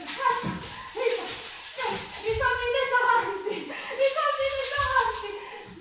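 voce molto più alta e lenta, beffarda; scherno. Acted. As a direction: fallo suonare come una beffa. Mocking the saints' money. (tono acuto e beffardo)

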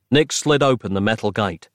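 ordinary delivery

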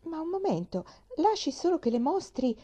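high, eager interjection. A narrator's reading. Girl rushing to fetch a mirror, eager. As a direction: break in with a sudden bright idea, pitch up. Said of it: "disse slanciandosi verso la specchiera".

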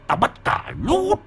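put-on possessed boy with an eerie womanish voice; shrill woman-like voice. A narrator's reading. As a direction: speak as the spirit inside him, ending piercingly high. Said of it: "giọng của nó the thé như giọng đàn bà".

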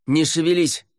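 high, urgent yell; shouted command. A narrator's reading. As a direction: shout it to freeze her in place. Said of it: "крикнул он".

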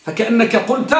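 ordinary talk

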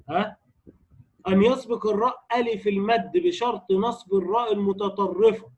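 ordinary talk, nothing marked

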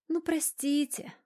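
high, drawn-out apology; guilty drawl. A narrator's reading. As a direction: stretch it out sheepishly, small and high. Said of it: "виновато протянула моя нимфа". (виновато, протяжно и высоко)

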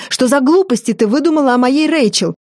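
mother-in-law defending her maid; indignant tirade. A narrator's reading. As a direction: rant in shrill outrage. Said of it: "вознегодовала она".

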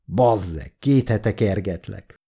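plain reading voice

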